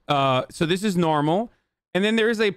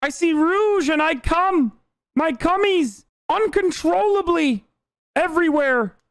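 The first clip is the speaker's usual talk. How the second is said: put-on babyish creep character; 10 semitones higher than usual. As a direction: whine it like an overgrown baby. Acted absurd creep character.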